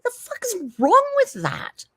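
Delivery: slow, high mock outrage; indignant. Real, not acted. Streamer mock-offended at being snubbed by her kid. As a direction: drag out the offended question. Indignant retort to imagined snub.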